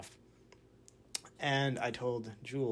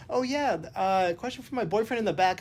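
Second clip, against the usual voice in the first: much higher, louder playful call; imitating the dancer; 9 semitones higher, 9 dB louder than usual. Dancer calling on a fan at a Q and A, bright and much higher. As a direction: point to the fan like a cheery host. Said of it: "and she's like".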